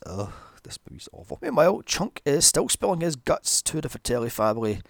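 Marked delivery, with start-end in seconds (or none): 0.0-1.4 disgusted whisper. Real, not acted. Disgusted aside.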